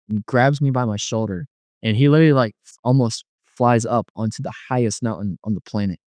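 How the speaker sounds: ordinary talk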